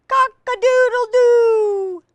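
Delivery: high, loud, sustained crow; rooster crowing. A narrator's reading. Reader gives the rooster sound everybody knows, high loud crowing. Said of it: "crowed the rooster".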